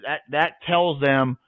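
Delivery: ordinary delivery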